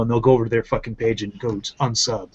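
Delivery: ordinary talk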